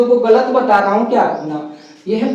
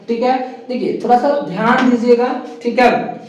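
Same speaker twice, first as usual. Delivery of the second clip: ordinary talk, nothing marked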